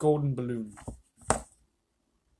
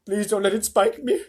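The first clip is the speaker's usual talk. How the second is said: high, louder frightened plea; pleading; 6 semitones higher, 5 dB louder than usual. A narrator's reading. Earthworm as bait begging James, anxious pleading. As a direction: beg anxiously to be spared. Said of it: "begged the earthworm".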